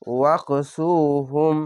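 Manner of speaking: ওয়াকসুহুম slow, the long vowel stretched one alif; drawn-out recitation. Shown as a demonstration. Quran teacher modelling a slow, stretched recitation. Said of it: "এক আলিফ টেনে পড়বেন".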